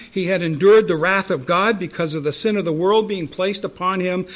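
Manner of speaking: ordinary talk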